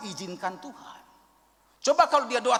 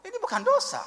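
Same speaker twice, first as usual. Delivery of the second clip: much higher, insistent retort; stubborn insistence. Retold or imitated. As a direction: mimic him insisting it's not sin. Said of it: "ini ngotot dia". (adik yang ngotot, nada tinggi)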